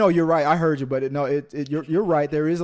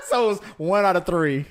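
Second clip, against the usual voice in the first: laughing while talking; 4 semitones higher, 4 dB louder than usual. Real, not acted. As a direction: speak through laughter. Laughing at own story.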